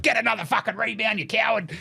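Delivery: high-pitched heckling voice; imitated high angry demand; mock berating. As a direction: heckle like an irate bettor. Mimics stats boy berating player.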